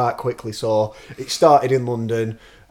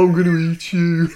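mock zombie threat, higher and louder; 6 semitones higher, 5 dB louder than usual. Friends joking, mock zombie threat. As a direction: ham up a hungry zombie.

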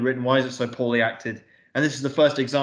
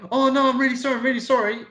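much higher, hammed-up grovelling apology; a high, mocking imitation of a timid heroine; mocking feeble apology; 11 semitones higher, faster than usual. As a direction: send up her backing down in a high put-on voice.

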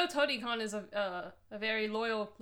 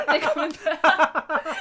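loud, slow, through real laughter; laughing; 6 semitones higher, 13 dB louder, much slower than usual. Real, not acted. Co-host cracking up at glow sticks in bags, laughing loudly. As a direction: crack up while repeating it, drawn out.

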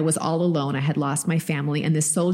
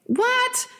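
a sudden, much higher squeak of disbelief; stunned what. Real, not acted. Mom recalling her son's strange memory to her cohost, stunned and much higher. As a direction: gasp it out in pure disbelief.

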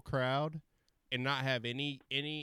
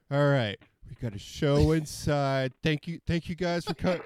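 rehearsed announcement; 4 semitones higher, 7 dB louder than usual. Retold or imitated. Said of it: "rehearsing what I'm gonna say".

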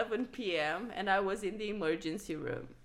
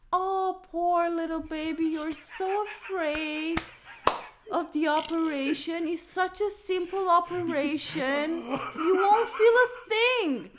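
soothing doctor; 6 semitones higher, 3 dB louder than usual. Retold or imitated. Doctor soothes a child he thinks is scared, mock cooing. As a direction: talk down to a frightened little patient. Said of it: "the doctor looked at me and he was like".

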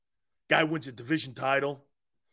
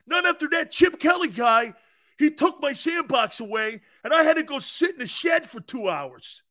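high, shouted whiny complaint; higher and louder, a spoiled kid ranting; mocking Howie shouting; 9 semitones higher, 3 dB louder than usual. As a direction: whine loudly about losing the sandbox. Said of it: "Not even Doug?".

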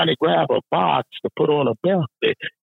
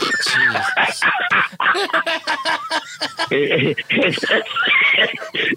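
shrieking laugh. Real, not acted. Friends hear the Super Bowl excuse, a shrieking laugh. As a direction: let out a high howl of laughter.

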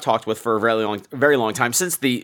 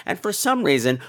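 ordinary talk

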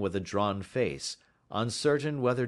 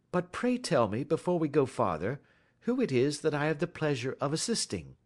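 lightly voiced courteous detective, a bit higher; Sherlock Holmes; 7 semitones higher than usual. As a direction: inquire with polite assurance.

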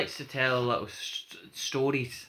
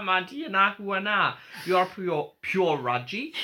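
put-on Geordie accent; 5 semitones higher, 3 dB louder than usual. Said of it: "I'm hamming up my Geordie accent".

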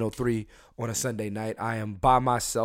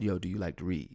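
quiet, lower pickup line; soft smooth line; 7 semitones lower, 10 dB quieter, faster than usual. Retold or imitated. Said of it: "that's why I said".

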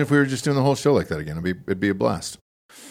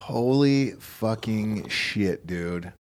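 very slow, soft astonishment; quietly stunned, trailing away; hushed disbelief; 6 dB quieter, much slower than usual. Real, not acted. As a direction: let it sink in, drawing out each word. Still stunned after the clip.

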